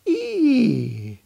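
very high, sudden squeal of delight; a little deer squealing, overwhelmed by snowflakes; excited deer squeal. A narrator's reading. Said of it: "he gets all excited".

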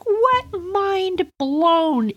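very high, drawn-out amazement; amazed. Retold or imitated. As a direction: stretch it out, stunned and delighted. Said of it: "I was like".

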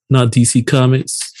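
ordinary delivery

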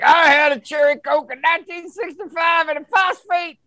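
mock angry reviewer.

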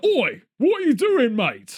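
mock hard-man challenge to a mate; Vinnie Jones. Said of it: "There's never been a Vinnie Jones politician".

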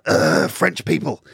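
slow disgusted ugh, imitating his wife; a mock groan of distaste. Wife scoffing at other French tourists.

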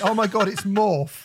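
high gushing starstruck cry; mock excited fan. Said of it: "people ... gone".

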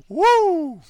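high, loud wrestler's woo; Ric Flair woo. Retold or imitated. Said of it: "Ric Flair energy".

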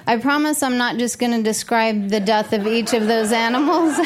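usual speaking voice

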